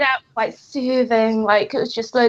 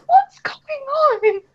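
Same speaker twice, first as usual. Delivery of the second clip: high-pitched, mock-alarmed wail; retold frightened panic; 11 semitones higher, 4 dB louder, faster than usual. Listener alone with spooky radio at night, retold fright. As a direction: re-enact being scared by the radio, much higher. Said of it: "and I was frightened".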